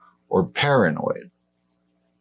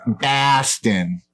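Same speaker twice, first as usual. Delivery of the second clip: Boston said as pahstin, higher; mock Boston accent on the city name; a light Boston accent; 4 semitones higher than usual. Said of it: "That's my Boston accent".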